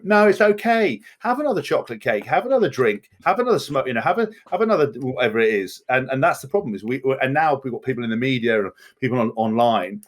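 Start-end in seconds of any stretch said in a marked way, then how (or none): 0.0-5.8 high, coaxing mock indulgence; mock enabler. Enablers urging more cake, in a high coaxing mock voice. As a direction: sweet-talk someone into another treat, pitched up. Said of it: "people that just went".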